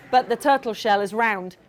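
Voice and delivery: plain reading voice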